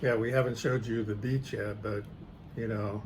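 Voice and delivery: ordinary talk, nothing marked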